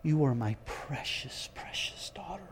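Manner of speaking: soft, near-whispered endearment; tender voice of God. Shown as a demonstration. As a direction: hush it like a father to a sleeping child. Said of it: "It is a language of affection".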